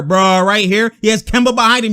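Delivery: ordinary talk, nothing marked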